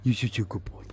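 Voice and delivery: sing-song praise for a pet. Real, not acted. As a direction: coo it to the dog. Praising the dog.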